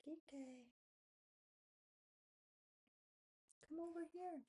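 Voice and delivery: high coaxing call; coaxing the cat. Real, not acted. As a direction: coax the cat to come over. Calling her cat.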